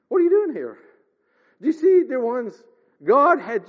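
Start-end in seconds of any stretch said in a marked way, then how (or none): none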